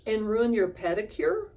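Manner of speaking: aghast at the idea; gasped. A narrator's reading. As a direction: stepmother horrified Ellie would try it on. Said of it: "her stepmother gasped".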